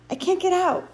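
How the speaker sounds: high, loud and desperate; frantic, frustrated. A narrator's reading. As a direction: raise the voice in trapped frustration. Wendell frantic, trapped, after frustrated yell.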